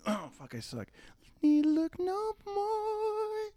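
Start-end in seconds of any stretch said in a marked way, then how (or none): 1.4-3.5 put-on high slow child singing; kid voice. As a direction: croon high like a young boy. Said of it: "let me do the kid voice".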